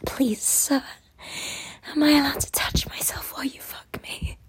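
breathy begging whisper. Acted. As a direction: whisper close and needy. Long whispered run.

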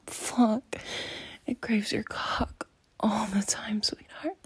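usual speaking voice

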